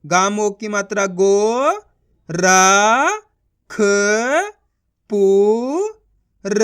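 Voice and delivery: गो, रा, ख, पू, र one by one; syllable sounding out. Shown as a demonstration. Teacher spelling a word for learners, sounding out. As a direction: draw out गो, pause between the letters. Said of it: "उनका उच्चारण करो".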